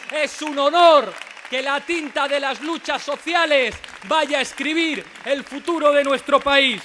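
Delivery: loud, soaring campaign speech; rousing rally shout. Real, not acted. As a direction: belt it out to a packed crowd. Rally speech to cheering crowd.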